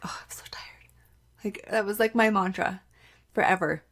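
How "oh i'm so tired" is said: soft whispered groan of tiredness; exhausted whisper. Retold or imitated. Mom climbing the stairs, whispering her tiredness.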